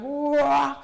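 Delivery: swelling, loud imitated "wah"; crescendo "wah". Shown as a demonstration. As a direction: surge up through a single "wah". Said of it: "that same kind of".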